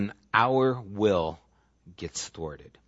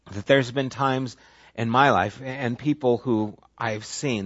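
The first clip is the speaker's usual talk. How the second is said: ordinary talk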